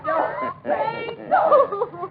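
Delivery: screaming, pleading for her life; shrill panicked cry of no; terrified scream. Acted. Woman pleads before being shot.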